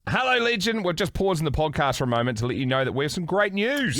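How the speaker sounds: bright radio ad read. Acted. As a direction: sell it like a radio ad. Host reads an ad.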